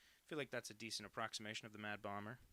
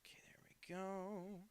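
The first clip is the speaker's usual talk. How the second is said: whispering; 8 semitones higher, 4 dB quieter, slower than usual. Real, not acted. Quiet aside while drawing.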